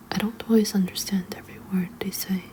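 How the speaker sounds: ordinary delivery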